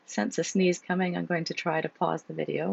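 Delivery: plain reading voice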